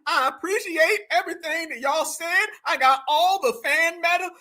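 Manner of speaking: hammed-up awards-night thanks; mock acceptance speech. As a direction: imitate a grateful award winner. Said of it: "listen how he's talking".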